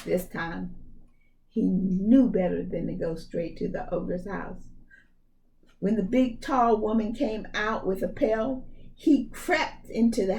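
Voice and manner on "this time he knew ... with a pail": plain reading voice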